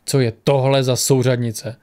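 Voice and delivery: usual speaking voice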